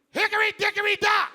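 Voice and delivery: high, loud random blurt; Bush yelling. Retold or imitated. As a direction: yell it out of nowhere at a press conference. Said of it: "Bush would just yell whatever was on his mind".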